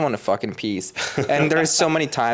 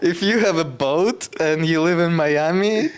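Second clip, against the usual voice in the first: slower, higher, teasing invitation to boat owners; flirty ad; 5 semitones higher than usual. Pretend. Telling listeners in Miami, a playful flirty call. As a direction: pitch it like a cheeky personal ad. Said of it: "I am looking for a sugar daddy".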